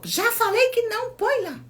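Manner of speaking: mother telling her toddler no, mock firm scold; imitated stern mother. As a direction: snap it like a mom laying down the rule. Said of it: "Aí ela falou".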